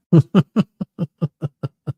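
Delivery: short real laugh, falling away; amused laugh. Real, not acted. Laughs after reading texts.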